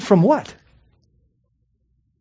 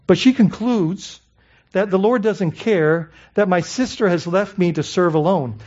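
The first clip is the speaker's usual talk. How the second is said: ordinary talk, nothing marked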